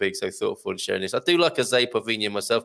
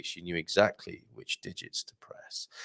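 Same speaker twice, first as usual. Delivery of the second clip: hushed, lower erotic-novel parody; soft whispered innuendo; mock-sultry whisper; 5 semitones lower, 9 dB quieter than usual. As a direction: whisper it like a steamy novel line.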